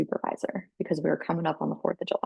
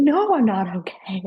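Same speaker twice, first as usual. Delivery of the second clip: retold upset outburst, higher and louder; crying upset; 4 semitones higher, 5 dB louder than usual. Telling supervisor on the phone she's not okay, crying upset. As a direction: act out a distraught protest, pitched up. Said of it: "I started just fucking crying on the phone".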